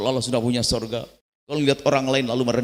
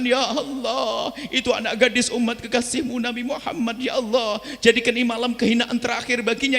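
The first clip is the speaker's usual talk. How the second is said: higher, pleading prayer to God; tearful prayer; 8 semitones higher than usual. Acted. Believer praying for a drunk youth, tearful and pleading. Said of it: "menengadahkan tangan".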